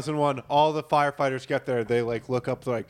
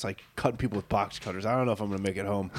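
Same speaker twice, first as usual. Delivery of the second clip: low, soft murmur; subdued phone call; 8 semitones lower, 7 dB quieter, faster than usual. Acted. Passenger telling wife about the hijacking, subdued. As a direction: keep it down, trailing into a hurried close.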